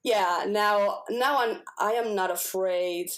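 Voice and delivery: ordinary talk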